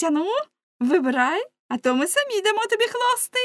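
put-on high, loud, teasing girls' voices; clamouring girls. A narrator's reading. Girls pressing Lamme to choose, high teasing clamour. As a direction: crow and taunt in a high pitch. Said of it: "закричали вони".

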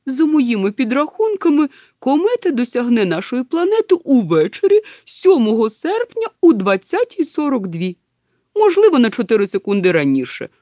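loud, high, emphatic professor; eager professor. Acted. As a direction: announce your calculations with relish. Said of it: "охоче розповідав професор". (охоче, гучно, по-професорськи)

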